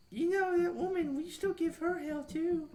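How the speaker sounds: high-pitched kid impression; little kids. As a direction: put on a squeaky-high kid voice. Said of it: "like, the little kids".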